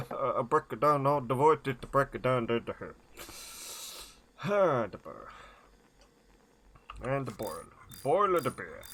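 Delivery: bubbly mock-Swedish nonsense syllables; Swedish Chef voice. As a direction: burble along in a lilting comic Swedish accent. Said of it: "the Swedish Chef voice".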